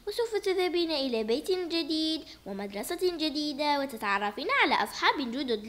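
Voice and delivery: usual speaking voice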